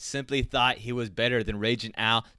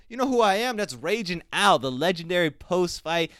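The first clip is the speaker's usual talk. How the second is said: boastful yell; 6 semitones higher, 3 dB louder than usual. Retold or imitated. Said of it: "And Raging Al goes".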